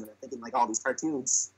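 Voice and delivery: usual speaking voice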